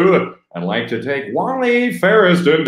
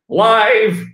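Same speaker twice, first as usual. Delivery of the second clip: voice crack; 6 semitones higher, 4 dB louder than usual. Retold or imitated. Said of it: "that was a cr-, voice crack".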